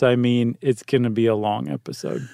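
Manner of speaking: normal talking voice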